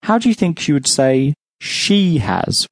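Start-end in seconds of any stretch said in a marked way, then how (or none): 1.6-2.7 "she" stretched out above "has"; stress on she. Shown as a demonstration. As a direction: put the emphasis firmly on "she". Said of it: "with emphasis".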